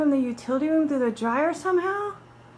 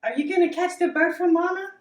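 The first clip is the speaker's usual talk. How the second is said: higher, louder coaxing to a cat; lilting question to a pet, rising at the end; pet talk; 6 semitones higher, 6 dB louder than usual. Real, not acted.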